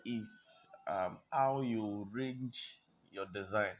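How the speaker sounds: ordinary talk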